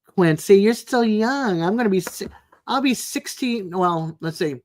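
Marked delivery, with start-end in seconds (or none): none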